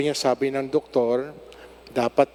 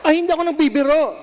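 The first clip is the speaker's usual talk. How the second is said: much higher, louder and fast; excited insistence; 13 semitones higher, 5 dB louder, faster than usual. Real, not acted. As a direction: insist eagerly over the laughter. Insisting to laughing congregation. (masigla at malakas na paggiit)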